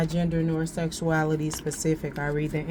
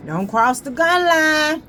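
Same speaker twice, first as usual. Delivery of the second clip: high, loud warning call; don't cross the line, loud and high; 11 semitones higher, 8 dB louder than usual. Retold or imitated. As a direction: shout it like a firm boundary. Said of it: "it's like, mm".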